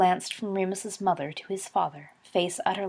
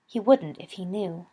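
ordinary delivery, nothing marked

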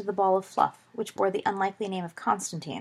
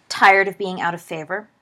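ordinary delivery

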